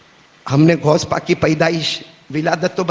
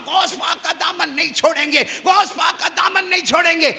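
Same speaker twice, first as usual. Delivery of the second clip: mock shout; 11 semitones higher than usual. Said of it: "میں نے بھی بہت زور سے کہا".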